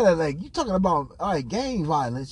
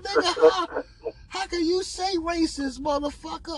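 high-pitched disbelief; 11 semitones higher than usual. Retold or imitated. Man recounting his reaction to the racism remark, high-pitched disbelief. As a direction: protest in a much higher voice.

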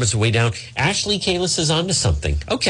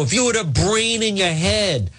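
high mock shout at an aide; hammed-up scolding of a staffer; berating Eva in mock fury; mock angry yell; 7 semitones higher than usual. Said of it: "Yelling at Eva Mancuso".

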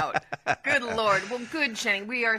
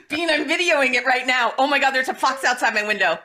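laughing, thrilled and faster; excited; 5 semitones higher, 4 dB louder than usual. Real, not acted. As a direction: gush about the fox outside. Filming a fox, laughing.

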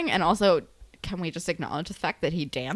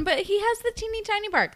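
high mock tiny-dog voice; teeny tiny voice; 12 semitones higher than usual. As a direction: shrink the voice to match a tiny dog. Mimicking a tiny dog's bark.

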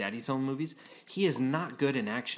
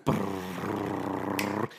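lip trill; 5 semitones lower than usual. Pretend. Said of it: "It's my turn to intro you".

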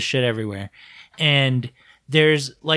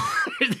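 breathy high laugh, softer; 6 dB quieter than usual. Real, not acted. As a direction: laugh up high and let it fade. Laughing at joke.

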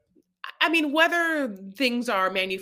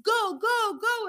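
higher, louder hurry-up shout; urgent yell; 13 semitones higher, 4 dB louder, slower than usual. Retold or imitated. Husband yelling at wife to drive, urgent. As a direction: push her to floor it.

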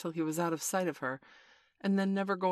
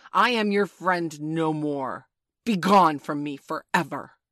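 loud, emphatic banishment, starting slow; stern cry; 11 dB louder, slower than usual. A narrator's reading. Peter banishes Tinker Bell for her crime, in a loud stern cry. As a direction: cast them out at full, stern volume. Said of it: "he cried".

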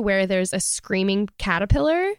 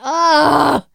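loud, higher groan of disgust; 7 semitones higher, 8 dB louder than usual. Real, not acted. Complaining about hated episode, loud disgusted ugh. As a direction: let out real revulsion.

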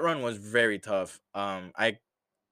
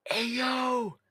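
high sustained call to the leg; brain calling out. Pretend. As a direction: shout across to someone, high and held. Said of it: "He was like".

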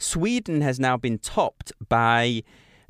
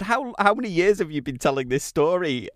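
higher-pitched, amused disbelief; retold incredulity; 7 semitones higher than usual. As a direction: quote yourself asking in mock disbelief. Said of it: "And I said to him, I said".

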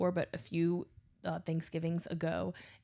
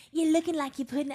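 high, louder Cajun grandma impression; Cajun grandma accent; 7 semitones higher, 4 dB louder than usual. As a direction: tease like a Cajun grandma, pitched up. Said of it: "this is my Cajun grandma accent".

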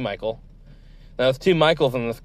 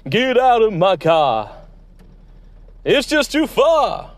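slow, loud and high get-out order; angry order out; 9 semitones higher, 5 dB louder, slower than usual. Acted. Host kicking the guest out of his car, a loud slow command. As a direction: throw him out of the car, loud and drawn out.

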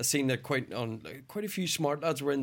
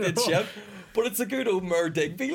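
higher, hammed-up Irish accent; 6 semitones higher than usual. Irish accent, naming the dog for his podcast mates. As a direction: lay on the Irish lilt for the dog's name. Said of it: "your best Irish accent".

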